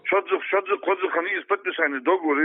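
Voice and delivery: ordinary talk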